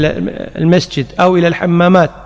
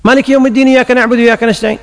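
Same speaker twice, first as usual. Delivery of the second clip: fast and higher recitation; rushed recitation; 6 semitones higher, much faster than usual. Shown as a demonstration. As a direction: rattle it off to keep pace. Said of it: "أسرع تسرع". (تلاوة مسرعة وعالية النبرة)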